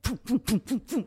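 loud playful pum pum sound effects; mock head-turn noises. Journalists turning to stare at the new girl, mock sound effects. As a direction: bounce out each pum like heads snapping round.

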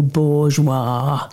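"bourgeois" with the final "wah" drawn out; stress on last syllable. A narrator's reading. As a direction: lean on the end of "bourgeois": boor-zhWAH. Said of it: "He puts the emphasis on the last syllable".

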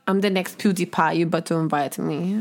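low, mock-menacing Russian voice; slightly Russian accent. Podcaster jokingly threatening VidCon for an invite, bad Russian accent. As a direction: make a joke threat in shaky Russian. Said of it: "it's slightly Russian".

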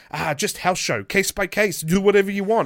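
high, loud, carefree dismissal; imitated breezy brush-off. Imitating the booker winding up a nervous wrestler, breezily dismissive. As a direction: wave the question away, high and loud.

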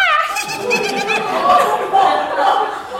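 high, loud cackling giggle; mad child giggle. Retold or imitated. Daughter holding the gun, high mad giggle. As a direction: giggle like a delighted little mischief. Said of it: "this laughter continued".

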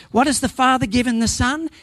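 ordinary talk

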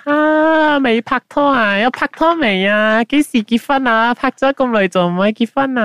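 high, prying auntie voice in Cantonese; nosy auntie. Retold or imitated. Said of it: "the auntie will go like".

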